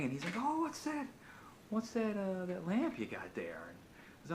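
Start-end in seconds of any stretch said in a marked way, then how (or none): 0.4-3.6 high, eager king spotting a prize; curious king. Acted. King eyeing the beggar's lamp with eager greed. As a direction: snap to attention, then slow and sly.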